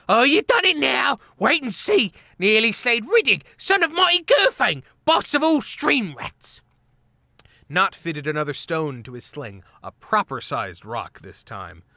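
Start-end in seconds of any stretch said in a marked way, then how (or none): 0.1-6.6 high, loud squeaky shriek; shrieking rage. A narrator's reading. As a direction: scream threats in fury. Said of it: "livid with rage, shrieking out".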